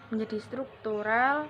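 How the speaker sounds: ordinary talk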